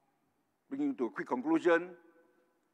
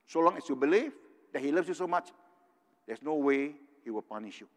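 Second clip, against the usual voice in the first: ordinary talk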